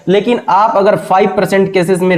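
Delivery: usual speaking voice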